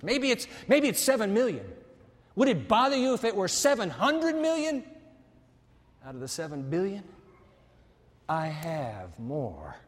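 ordinary talk, nothing marked

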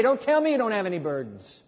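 usual speaking voice

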